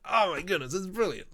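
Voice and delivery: high-pitched, excited exclamation; delighted outburst. Real, not acted. Streamer delighted at his idea of accent-swapped synths, excited. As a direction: burst out, tickled by your own idea.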